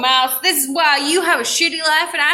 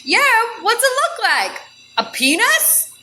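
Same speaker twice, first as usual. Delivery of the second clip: sausage character; 10 semitones higher than usual. Acted.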